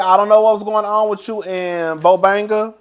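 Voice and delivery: ordinary talk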